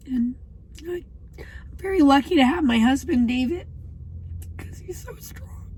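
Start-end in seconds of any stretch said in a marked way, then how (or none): 4.6-5.7 tearful whisper. Real, not acted. Wife tearfully praising her husband, choked whisper. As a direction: voice dropping away to a real whisper.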